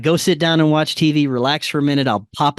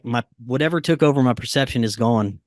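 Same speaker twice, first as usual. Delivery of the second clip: ordinary talk, nothing marked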